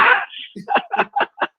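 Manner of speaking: tipsy high-pitched giggle. Real, not acted. Tipsy caller laughing.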